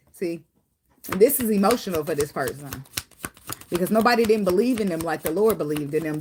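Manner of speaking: usual speaking voice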